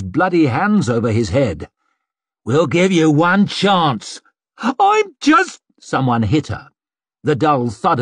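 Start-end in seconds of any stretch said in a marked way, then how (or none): 2.4-4.2 raised, slow, menacing demand; angry threat. A narrator's reading. Attacker to the captured maid, slow angry threat. 4.6-5.6 very high, panicked protest cut short; terrified squeal. A narrator's reading. Maid pleading before someone hits her, squealing.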